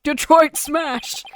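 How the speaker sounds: drawn-out superhero battle cry; All Might. Acted. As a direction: call out the attack, very slowly. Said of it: "balance voice slash All Might".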